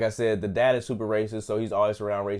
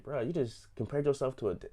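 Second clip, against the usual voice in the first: incredulous whisper; 4 semitones higher, 5 dB quieter than usual. Retold or imitated. Mock reaction to Peacemaker.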